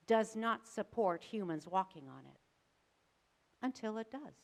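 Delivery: ordinary talk, nothing marked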